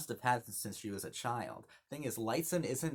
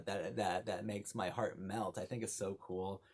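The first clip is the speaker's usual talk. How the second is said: ordinary talk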